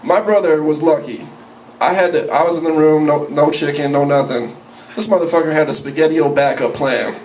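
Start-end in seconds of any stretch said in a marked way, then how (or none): none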